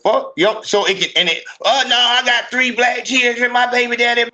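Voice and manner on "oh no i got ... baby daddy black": high, sing-along monotone mimicry of her excuse; mock her excuse in a high, level voice; imitated defensive woman.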